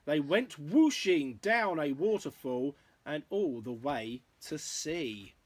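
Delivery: lilting, rhythmic picture-book read; story narration. A narrator's reading. Parent reading a dinosaur rhyme to a child, story narration. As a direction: read in bouncy verse rhythm.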